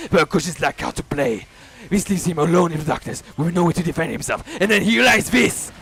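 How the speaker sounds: loud, driving horror telling; frantic climax narration. A narrator's reading. Narrator racing through Koji's terror, loud and frantic. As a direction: push the panic through every line.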